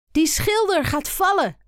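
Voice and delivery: hoger en luider uitgeroepen; roepend. A narrator's reading. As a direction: roep het geschrokken uit. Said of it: "roept Koen". (kind ziet een schilder wankelen en roept het uit)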